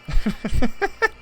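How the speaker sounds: high rising giggle; giggling laugh. Real, not acted. Player giggling at a cruel event, high giggle. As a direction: let a giggle escape.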